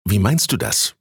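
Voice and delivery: plain reading voice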